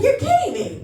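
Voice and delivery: high, laughing you're kidding me; mock disbelief. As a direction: squeal it in amazement. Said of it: "I was like".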